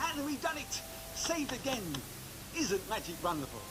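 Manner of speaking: put-on bright, high showman patter for kids; kids party magician. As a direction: dazzle the children with the trick. Performing trick for children.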